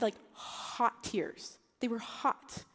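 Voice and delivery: ordinary delivery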